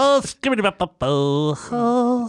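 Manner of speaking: a hammed-up Popeye mumble, slow. Comparing Popeye's two voices, doing his slow scat. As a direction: growl out Popeye's scat, slowly.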